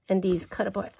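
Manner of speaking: normal talking voice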